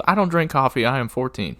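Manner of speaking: put-on prim young girl; Mattie's reply. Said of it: "she goes".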